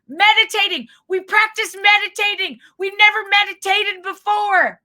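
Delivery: high, loud flustered mom spelling it out; high, loud panicked mom over-explaining; frantic embarrassed mom. Retold or imitated. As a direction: push it out high and loud in mock mortification. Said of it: "she wrote out the word".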